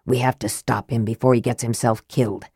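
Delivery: low, soft, bitter undertone; understated bitterness, pitched low. A narrator's reading. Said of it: "Raistlin added bitterly".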